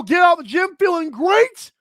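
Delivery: usual speaking voice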